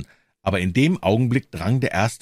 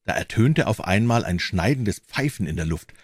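usual speaking voice

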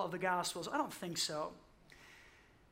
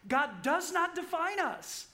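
raised preaching; 8 semitones higher than usual. Real, not acted. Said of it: "Just let that fall into your soul".

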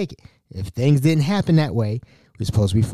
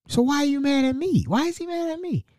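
high, softer plaintive question; acted-out "what did I do" pleading; comically wounded, voice up high; baffled protest; 8 semitones higher, 4 dB quieter than usual. Protesting the man's grudge.

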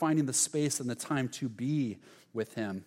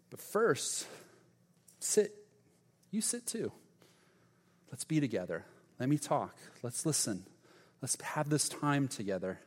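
tender; 6 dB quieter than usual. Retold or imitated. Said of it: "tenderness in his face".

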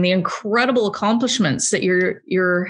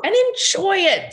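excited shout; 10 semitones higher than usual. Real, not acted. Excited urging about first sale.

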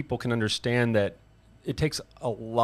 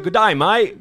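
put-on loud, higher-pitched Aussie accent; broad Australian vowels, pitched up and loud; 6 semitones higher, 9 dB louder, slower than usual. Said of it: "in an Australian accent".

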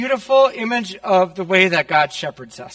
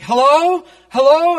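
high, loud mock-desperate hello; 11 semitones higher, 8 dB louder, slower than usual. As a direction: call out as the panicked aunt, pitch shooting up. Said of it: "And she's desperate".

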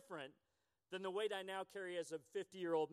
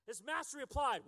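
ordinary talk

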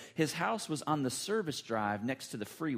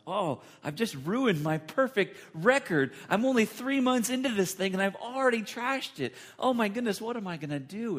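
panicked inner thought; 8 semitones higher, 4 dB louder than usual. Retold or imitated. Panicked inner thought after crash.

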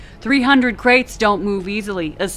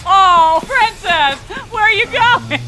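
mocking taunt; 11 semitones higher, 6 dB louder than usual. Mocking taunt at fleeing crewmate.

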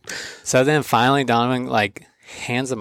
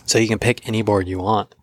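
normal talking voice

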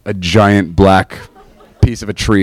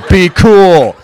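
embarrassed scolding; 8 semitones higher, 4 dB louder than usual. Retold or imitated. Acts out scolding his sobbing friend.

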